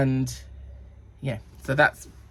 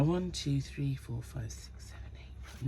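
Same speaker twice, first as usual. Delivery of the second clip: very soft, fading into a whisper; half-whispered tally, barely voiced; counting under breath; 10 dB quieter than usual. Real, not acted. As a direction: count quietly to yourself. Counting sizes off to herself.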